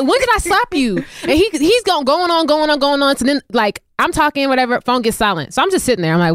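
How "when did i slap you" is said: high-pitched retold disbelief; incredulous challenge. Storyteller replays challenging the manager's accusation, incredulous. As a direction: replay the question pitched up, affronted.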